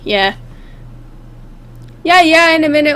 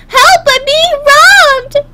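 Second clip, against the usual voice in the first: very high, slow, hammed-up panic; mock cry for help; 13 semitones higher, 4 dB louder, much slower than usual. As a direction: wail for help, stretching the words. Acts robbery victim.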